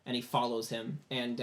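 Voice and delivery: ordinary talk, nothing marked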